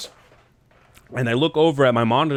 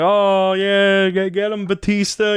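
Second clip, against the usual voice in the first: high, loud drawn-out cheer; cheering crowd; 7 semitones higher, 4 dB louder, slower than usual. Retold or imitated. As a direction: egg the wrestler on like a fan. Acts out cheering crowd at match.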